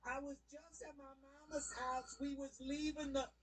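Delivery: high, put-on voice of the mother making excuses; high mock voice of the mother. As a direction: mimic her lame excuse. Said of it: "She goes".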